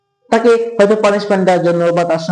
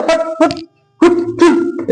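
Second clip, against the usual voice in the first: bump sound noises; 10 semitones higher, slower than usual. Shown as a demonstration. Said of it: "আমরা বলি না".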